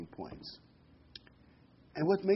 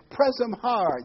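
loud, higher coach call; coach's shout; 9 semitones higher, 7 dB louder, slower than usual. Retold or imitated. Basketball coach urging defense, loud call. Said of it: "the coach says on defense".

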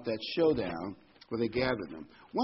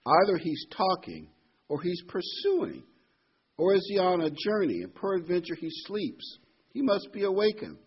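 mocking; 6 semitones higher, 5 dB louder than usual. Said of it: "Elijah mocked them and said".

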